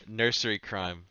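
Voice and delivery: laughing through the title. Real, not acted. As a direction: chuckle as you say it. Laughs before naming the album.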